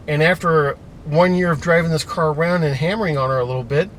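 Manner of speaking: usual speaking voice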